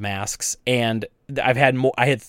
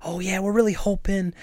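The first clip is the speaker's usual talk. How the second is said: mock-sweet shopkeeper thanking a masked customer; sugary politeness; 6 semitones higher than usual. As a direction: gush politely at the customer, pitched up. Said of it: "I'm not even going like".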